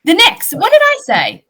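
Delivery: startled. Real, not acted. Host startled at being corrected.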